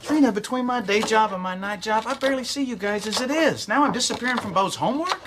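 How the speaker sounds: worked-up, hurt indignation; upset protest. Acted. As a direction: protest to your wife about being left out. Husband upset at being forgotten.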